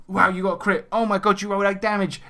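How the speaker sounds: high-pitched, hammed-up astonishment; imitated excited game master reaction; mock amazement. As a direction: act wowed by a player's lucky roll.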